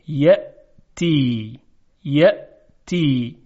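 یأتی said very slowly, with a strong, clear glottal stop on the hamza; hamza in yaʼtī said with force. Shown as a demonstration. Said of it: "این تیزی صدای همزه رو اگر دقت کنید". (یأتی را آهسته و با نبرِ کامل همزه بگویید)

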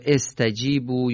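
استجیبو begun with "e": estajibu; kasra on alef. Shown as a demonstration. Teacher offering a second reading, استجیبو said "estajibu". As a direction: open استجیبو on a short "e" sound.

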